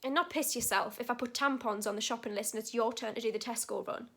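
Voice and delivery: usual speaking voice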